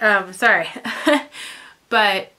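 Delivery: ordinary delivery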